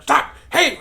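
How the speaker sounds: sudden high, loud mock yell; mock scolding shout. Dog owners scolding their barking dog, mock shout. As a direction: scold the dog in a sharp burst.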